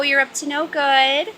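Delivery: ordinary talk, nothing marked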